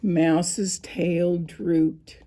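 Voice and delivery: ordinary delivery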